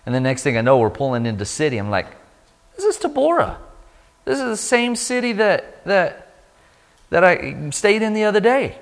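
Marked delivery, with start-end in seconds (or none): 2.8-8.8 very high, halting disbelief; incredulous. Retold or imitated. Bus passenger realizes he is in the wrong city, incredulous. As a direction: squeak it out in mock dismay, haltingly.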